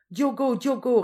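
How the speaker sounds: high, loud repeated cry; distressed calling. A narrator's reading. Old mother calling the fisherman running away, distressed cry. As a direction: call after him, close to fainting. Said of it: "répéta la vieille Josepha, prête à se trouver mal".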